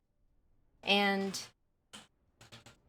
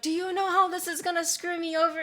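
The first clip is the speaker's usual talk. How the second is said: panicked boss; 9 semitones higher than usual. Retold or imitated.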